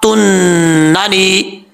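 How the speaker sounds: तुन्नानी slow with a doubled n; तुन्नानी recited at half speed; recitation demo. Shown as a demonstration. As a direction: stretch तुन्नानी and hold the nn. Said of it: "अब इसके बाद है ला ता".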